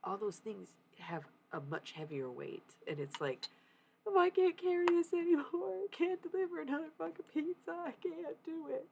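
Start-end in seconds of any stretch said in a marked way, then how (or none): 4.1-8.9 high, overwhelmed wail, easing from loud; freaking out. Retold or imitated. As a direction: imitate an exhausted meltdown, dragging the end. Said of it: "you're already prone to freaking out".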